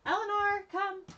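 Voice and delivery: high-pitched summons; calling out. Real, not acted. Calling Eleanor to come over.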